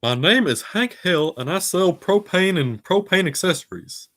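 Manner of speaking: high, proud salesman introduction; Hank Hill impression. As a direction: announce yourself as the propane man. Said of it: "that one's fun to do".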